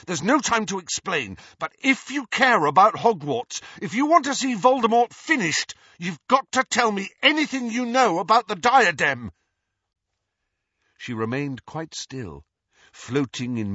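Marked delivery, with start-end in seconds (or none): 0.1-9.3 raised, building urgent demand; fierce. A narrator's reading. Harry demands what the ghost knows, fierce urgency. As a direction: press harder with each clause. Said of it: "Harry said fiercely".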